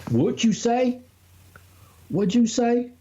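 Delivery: quizzing a friend in a silly country voice; mock country voice. High mock character questioning.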